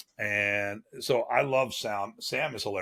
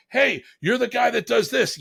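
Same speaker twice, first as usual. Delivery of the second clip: much higher, thrilled realization; excited recognition; 12 semitones higher, 4 dB louder than usual. Retold or imitated. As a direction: call it out like you just spotted someone famous. Driver suddenly recognizes him.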